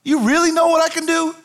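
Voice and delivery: high, pressing challenge; Jesus challenging. Acted. Jesus pressing Martha on what he can do, high and intense. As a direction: press her, do you really know me.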